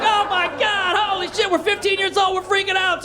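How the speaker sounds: high-pitched mock panic; freaking out. Teens chased by a man in a car, mock freaking out.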